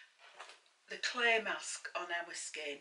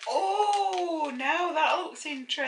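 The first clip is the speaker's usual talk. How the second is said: long, high, loud ooh of interest; intrigued delight; 8 semitones higher, 8 dB louder than usual. Real, not acted. Mum reacting to a newly shown product, intrigued delight. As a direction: raise the pitch and volume, very intrigued.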